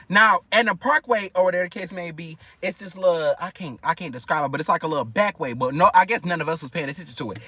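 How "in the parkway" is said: ordinary talk, nothing marked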